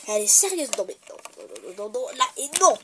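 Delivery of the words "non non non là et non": usual speaking voice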